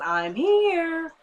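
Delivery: high, stretched-out arrival call; mock sing-song announcement. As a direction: draw it out high, teasing. Mimics Randall walking in unexpectedly.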